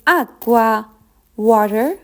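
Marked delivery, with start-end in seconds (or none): none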